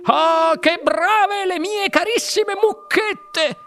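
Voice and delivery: very high, slow adoring coo; Wonka esclama. A narrator's reading. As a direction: gush high and drawn-out over beloved pets. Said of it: "esclamò il signor Wonka". (esclamazione acutissima, lenta e adorante)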